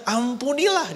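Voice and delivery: high, firm command; Paul commanding. Acted. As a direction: hand down the answer like Paul. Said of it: "dia bilang". (Paulus memerintah dengan tegas, nada tinggi)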